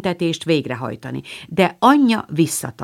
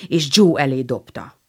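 ordinary delivery

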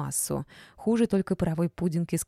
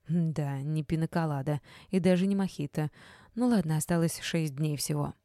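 usual speaking voice

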